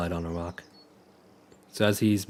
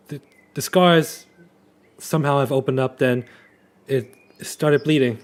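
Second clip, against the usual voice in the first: anxious pleading; 3 semitones higher, 4 dB louder than usual. Acted. Riolus pleading with Snips.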